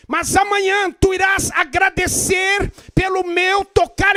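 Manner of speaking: loud fervent preaching, slowing on key words; preaching at full force. Acted. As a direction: drive God's promise home to the crowd. Preacher voices God, building up.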